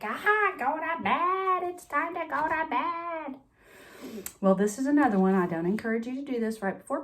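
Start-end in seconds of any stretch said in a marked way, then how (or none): 0.0-3.4 high, sing-song mommy at bedtime; a mom gently sending a kid to bed, pitched up. Retold or imitated. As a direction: mom telling her child it's bedtime, pitched high. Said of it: "mommy's just like".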